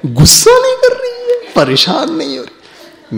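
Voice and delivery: laughing tease. Real, not acted. Teasing an amused mother.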